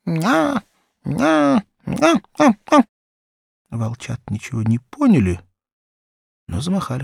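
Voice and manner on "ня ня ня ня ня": very high, squeaky puppy yaps; puppy calling the wolf cubs, high squeaky yaps; puppy whimpering. A narrator's reading. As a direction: yip it like a silly pup begging to play. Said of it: "положил на них морду и начал".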